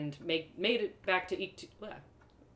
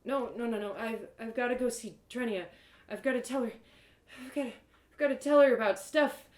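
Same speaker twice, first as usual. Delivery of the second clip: feverish pleading; 5 semitones higher than usual. Acted. Feverish narrator protests.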